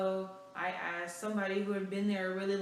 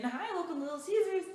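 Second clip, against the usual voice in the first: high, bright-eyed customer greeting; super peppy greeting; 9 semitones higher, 3 dB louder than usual. Retold or imitated. Peppy girl greeting every customer who walks in. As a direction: chirp it like the peppiest cashier. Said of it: "I was that overly excited, bubbly person".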